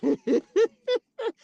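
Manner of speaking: a high burst of laughter. Real, not acted. Nurse laughing at her own remark, high burst of laughter. Laughs after "Child, listen.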